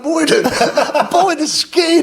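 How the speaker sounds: high-pitched, incredulous storytelling; excited, laughing climax. Real, not acted. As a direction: tell it cracking up at the chaos.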